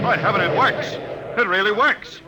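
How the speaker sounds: can't believe it works; amazed. Acted.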